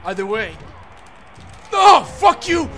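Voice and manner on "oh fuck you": loud, high shout of real anger; real gamer rage, pitched up; angry outburst. Real, not acted. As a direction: yell it furiously at the game. Player raging at the game.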